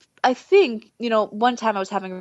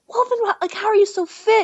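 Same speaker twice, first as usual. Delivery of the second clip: amazed question; 10 semitones higher, 5 dB louder than usual. Retold or imitated. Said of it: "And I'm like".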